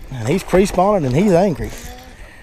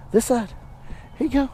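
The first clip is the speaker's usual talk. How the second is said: much higher coaxing pet voice; high baby-talk to a dog; doggy talk; 9 semitones higher, 6 dB quieter than usual. Acted. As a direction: coax the dog along, sing-song.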